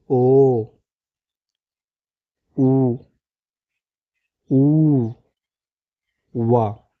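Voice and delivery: "ओ", "ऊ", "ऊ", "वा" as isolated, held sounds; teacher's stretched "ओ" and "ऊ", then "वा"; vowel sound demo. Shown as a demonstration. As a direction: sound out "ओ", "ऊ", "वा" one at a time with long gaps. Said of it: "इस तरीके से पढ़ सकते हैं".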